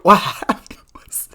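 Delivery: hushed whisper, slow, like a secret; whispered lead-in. Real, not acted. Vlogger starting the drama story in a slow whisper. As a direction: whisper it slowly as if telling a secret.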